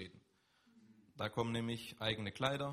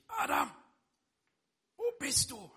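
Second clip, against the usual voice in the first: loud, much higher, harsh shout of the name; accusing call; 12 semitones higher, 6 dB louder than usual. Acted. God wrongly imagined yelling at Adam, loud and accusing. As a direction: bark it like an angry parent catching a child.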